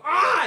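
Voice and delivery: short, high, loud scream; pained scream. Acted. Knights pulled across the circle, high scream. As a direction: yelp as if torn away. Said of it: "as they get pulled across".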